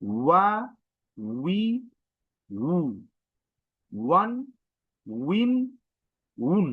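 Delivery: ওয়া, উই, উ, ওয়ান, উইন, উন each apart, with a w glide; pushed w sound. Shown as a demonstration. Teacher repeats the syllable drill, pushing the w. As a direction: push each from ওয়া to উন, pitch rising. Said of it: "একটা force নিয়ে বের হচ্ছে".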